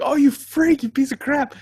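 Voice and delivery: high, indignant insult; mock outrage. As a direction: scold it in playful outrage. Insulting the selfish player.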